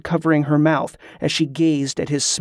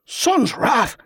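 high-pitched shouted oath; startled shout; 9 semitones higher than usual. A narrator's reading. Liam springs back from the tent, shouting in shock. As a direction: cry out in sudden shock. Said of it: "Liam shouted".

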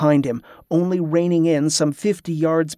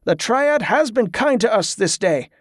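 higher-pitched address to a crowd; raised, carrying leader's call; 6 semitones higher than usual. A narrator's reading. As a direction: proclaim it to the gathered pilgrims. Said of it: "Donald called".

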